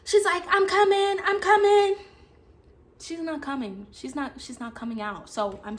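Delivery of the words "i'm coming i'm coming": higher, louder call back; retold friend, slightly singsong; lightly voiced friend. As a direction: call it back through a door. Friend calling back from bathroom.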